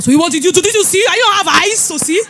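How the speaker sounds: loud, high, accusing questions; scolding husband. Retold or imitated. Husband scolds his wife about the scratched car, loud and high. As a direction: berate her for not looking.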